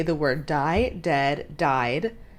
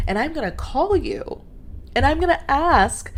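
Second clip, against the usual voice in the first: higher-pitched, sing-out sarcastic imitation; mocking entitled client; 6 semitones higher than usual. Client to worker at home on Christmas Eve, high mocking entitlement.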